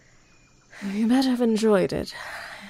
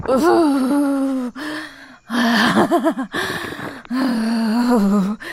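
fading moans; 3 semitones higher, 4 dB louder, much slower than usual. Acted. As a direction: come down slowly, out of breath.

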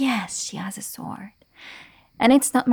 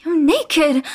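louder, higher startled cry; shocked exclamation; 5 semitones higher, 5 dB louder than usual. Acted. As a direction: blurt it out in shock. Sees thief naked.

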